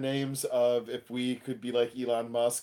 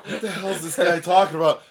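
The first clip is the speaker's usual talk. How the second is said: retold bafflement; 5 semitones higher, 8 dB louder than usual. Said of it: "looking at each other like".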